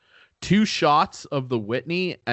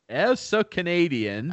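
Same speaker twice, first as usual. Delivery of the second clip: mock Canadian; 7 semitones higher than usual. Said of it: "you sounded".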